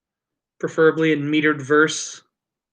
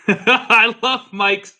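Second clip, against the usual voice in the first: laughing; 6 semitones higher, much slower than usual. Real, not acted. Laughing while tasting.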